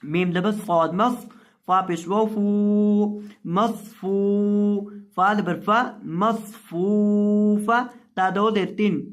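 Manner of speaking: slow chanted spelling, syllables drawn out; Quranic spelling recitation. Shown as a demonstration. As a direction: recite each syllable, then the whole word. (धीमी तिलावत में हिज्जे)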